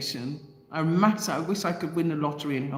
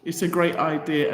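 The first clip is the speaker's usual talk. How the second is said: ordinary delivery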